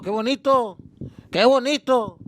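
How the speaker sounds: loud, high mock admiration; mocking heckle. Drunk dad heckling grandpa's story.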